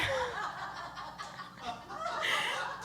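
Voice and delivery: high squeaky giggle. Real, not acted. Laughing at slide.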